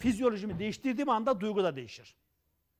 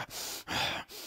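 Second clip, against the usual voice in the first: soft, heavy, breathy sigh; demo sigh; 8 dB quieter than usual. Shown as a demonstration. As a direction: let out a weary sigh to show the mood. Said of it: "aynen şu şekilde". (iç çekerek gösterim)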